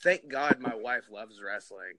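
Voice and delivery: ordinary talk, nothing marked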